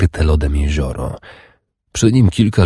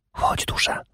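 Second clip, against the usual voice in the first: urgent hushed whisper; commanding whisper; 8 dB quieter than usual. A narrator's reading. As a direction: whisper the order softly. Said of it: "zakomendowała szeptem". (rozkazujący szept)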